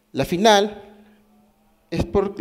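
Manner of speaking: ordinary delivery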